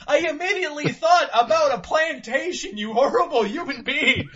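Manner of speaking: laughing through the words, loud. Real, not acted. Host laughing at his cohost's rocking-chair joke. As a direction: crack up while talking.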